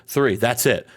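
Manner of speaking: ordinary talk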